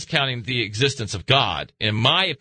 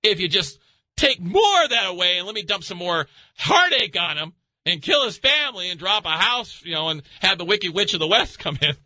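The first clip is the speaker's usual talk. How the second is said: voicing Lucifer; 8 semitones higher than usual. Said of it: "Lucifer goes".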